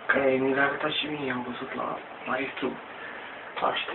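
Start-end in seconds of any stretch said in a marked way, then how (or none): none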